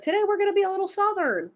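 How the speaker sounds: avatar talking. Acted. Avatar announcing today's accent to the streamer, lightly mimicked in a high voice. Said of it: "my avatar just be like".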